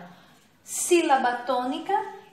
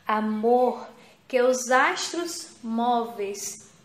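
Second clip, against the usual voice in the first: slow, louder, declaiming each line; poem reading; 4 dB louder, slower than usual. Acted. Teacher reading the textbook poem to pupils, slow and declaimed. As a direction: recite the little poem slowly to the class.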